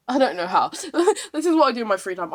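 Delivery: loud, laughing self-mockery. Real, not acted. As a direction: laugh through the words, loudly. Laughing at own boast.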